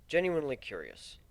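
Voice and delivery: ordinary delivery, nothing marked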